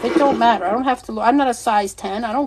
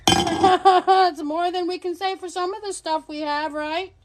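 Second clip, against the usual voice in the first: laughing; 6 semitones higher, slower than usual. Real, not acted. Laughing joke after bold claim.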